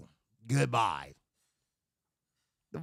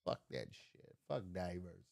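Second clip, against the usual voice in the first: low, much softer grumble; muttered aside; 11 semitones lower, 13 dB quieter, faster than usual. Real, not acted. Grumbling to himself about diversity, quiet mutter. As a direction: mutter it under your breath.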